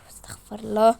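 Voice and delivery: ordinary talk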